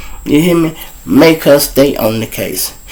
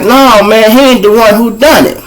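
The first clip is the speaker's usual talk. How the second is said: high, louder retold protest; insistent refusal; 10 semitones higher, 4 dB louder than usual. Victim refuses the police's frame-up, insistent high mimicry. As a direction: act out a witness firmly refusing, pitched up.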